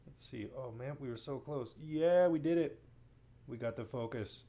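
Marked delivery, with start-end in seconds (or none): 1.8-2.8 real little cheer of success; quick proud whoop at the camera. Real, not acted. Narrator cheering to the viewer as the camera focuses, excited.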